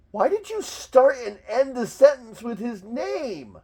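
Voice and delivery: high-pitched disbelief building to a shout; incredulous, climbing into a strained high yell; exasperated rant. Real, not acted. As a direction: lose it over a needless repetition. Rants at repeated name, building.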